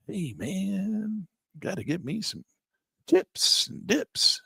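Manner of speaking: high, slow spaced-out hippie; stoner voice. Retold or imitated. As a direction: crave snacks like a stoned hippie. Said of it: "you gotta talk like that".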